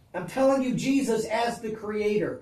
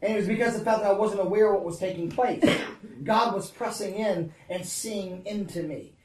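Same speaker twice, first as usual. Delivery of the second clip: ordinary talk, nothing marked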